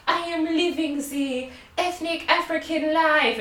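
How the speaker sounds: high-pitched put-on tourist voice; mock them as pretentious, spoiled kids; mocking spoiled European kids. Imitating European kids at a drum club, mocking voice.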